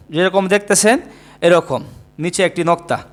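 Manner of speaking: ordinary talk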